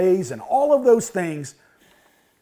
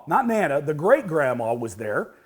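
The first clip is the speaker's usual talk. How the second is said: ordinary talk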